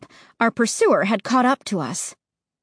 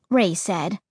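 usual speaking voice

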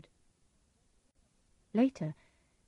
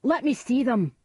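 higher, loud command; crying out; 6 semitones higher, 7 dB louder than usual. A narrator's reading. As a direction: demand it boldly. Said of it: "Macbeth cried".

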